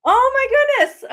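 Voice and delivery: high, loud exclamation of disbelief; real surprise. Real, not acted. Learns caller missed the message.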